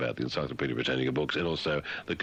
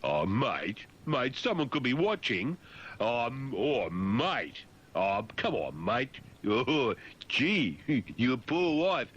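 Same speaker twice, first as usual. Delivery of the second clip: lightly voiced leering bloke, higher; mock bloke on a video phone; 5 semitones higher, slower than usual. Bloke on a video phone teasing his mate, lightly voiced. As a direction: egg your mate on, teasing. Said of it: "Blokes ringing blokes".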